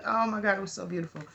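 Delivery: ordinary delivery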